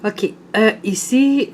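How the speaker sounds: normal talking voice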